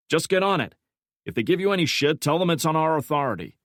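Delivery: high, loud impatient order; York commanding. A narrator's reading. Section chief brushing off his analyst, loud impatient order. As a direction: snap the order back over your shoulder.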